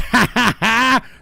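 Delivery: loud, high put-on cackle; mock gloating laugh. Trader laughing at fooled investors, loud mock gloating laugh. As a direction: laugh loudly in the losers' faces.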